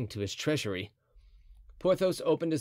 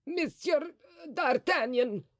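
astonished cardinal; slower than usual. A narrator's reading. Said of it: "muttered Mazarin".

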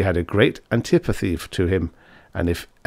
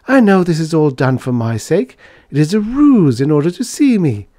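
put-on high, louder girlish voice; excited sweetheart, pitched up; lovestruck young lady; 8 semitones higher, 6 dB louder than usual. A narrator's reading. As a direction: gush fondly to your lover.

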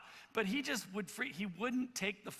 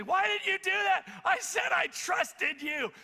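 put-on high, loud outraged kid; hammed-up indignant shouting, much higher; imitated yelling; 12 semitones higher, 7 dB louder, slower than usual. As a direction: yell it like a betrayed son. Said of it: "he's yelling".

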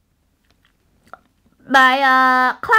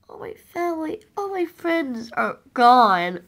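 mournful; 5 dB quieter than usual. Pretend. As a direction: drag it out, grieving softly. Mourning lost family, first take.

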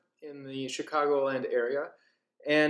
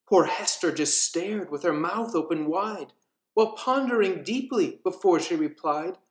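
rhythmic storybook reading with character voices; lilting Halloween storyteller; story narration; 4 semitones higher, 4 dB louder than usual. A narrator's reading. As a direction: read the rhyme like a spooky bedtime tale. Reads nursery rhyme aloud.

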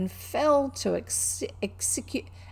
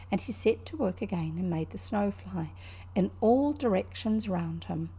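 ordinary delivery, nothing marked

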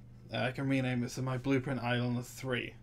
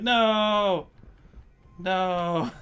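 mock despair; 4 semitones higher, 5 dB louder than usual. Falling in game, mock despair.

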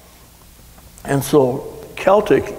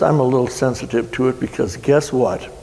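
ordinary delivery

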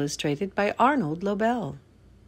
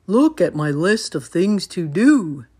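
lightly voiced proud Toad, louder; 6 dB louder than usual. A narrator's reading. Toad showing Frog his list, lightly voiced and proud. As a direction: show off in a loud Toad voice. Reads Toad's line in story.

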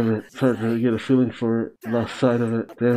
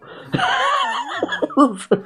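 very high squealing laugh; shrieking laugh. Real, not acted. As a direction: let out a shrill laugh. Laughing at the scene.

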